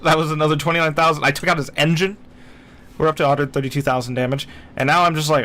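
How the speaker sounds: ordinary talk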